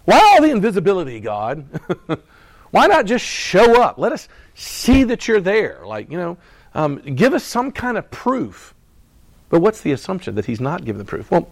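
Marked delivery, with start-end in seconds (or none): 0.0-8.7 mock plea to God.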